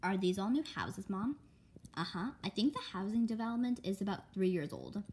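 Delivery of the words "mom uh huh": plain reading voice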